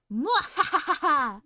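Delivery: loud, slow evil cackle; sneering panto villain laugh. Acted. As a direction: cackle like a hammy stage villain. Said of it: "I'm scared already".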